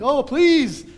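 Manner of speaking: high, loud pleading call, a bit hammed-up; mock anguished shout to Daniel; anxious calling out. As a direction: call down into the den, begging for an answer. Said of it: "he calls out like".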